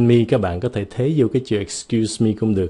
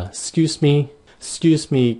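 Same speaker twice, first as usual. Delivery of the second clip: calling a waiter; 6 semitones higher, slower than usual. Shown as a demonstration.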